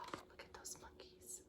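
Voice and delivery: gasp, then a hushed whisper; awed whisper. Acted. Storyteller showing children the monkeys, awed whisper. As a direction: whisper it in amazement, very softly. Gasping aside at the picture.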